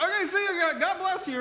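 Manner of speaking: very high, awkward farewell; flustered goodbye. Retold or imitated. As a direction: make a mortified high-pitched exit. Retells hurried embarrassed escape.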